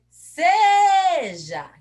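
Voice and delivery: SEJA shouted with a long high SEEE; calling the word. Shown as a demonstration. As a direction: call out SEJA, holding the first syllable loud. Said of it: "Quem que é forte?".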